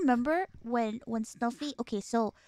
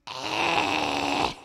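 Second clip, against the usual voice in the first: low unimpressed grunt. Retold or imitated. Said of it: "And I'm like".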